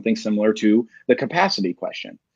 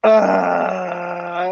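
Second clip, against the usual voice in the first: mock cry; 6 semitones higher than usual. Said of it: "I'm gonna make a little joke here".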